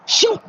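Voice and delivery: sudden high, loud swoosh noise; quick vocal sound effect of something rushing in; imitated whoosh. Imitated whoosh of arriving protectors.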